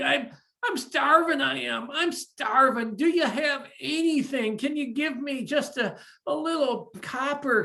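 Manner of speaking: high, pleading ragged beggar voice; begging beggar. Acted. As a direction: beg for a coin as if starving. Starving beggar begs for food.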